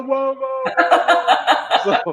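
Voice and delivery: high, loud whoa-whoa wail; mock the disruptive fan; imitated heckler. Retelling the audience member who drove her crazy, imitated heckler. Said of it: "someone in the audience that was doing this".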